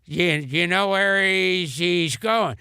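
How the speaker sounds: doddering, stammering old comedian impression; Bob Hope. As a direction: stumble through it as an aged comic on the phone. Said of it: "And he goes".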